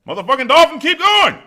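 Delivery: high, loud excited yell; excited shout. Retold or imitated. Telling friends to watch while recalling the sergeant, high loud shout. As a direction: shout it in amazed triumph. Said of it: "looked at all my friends like".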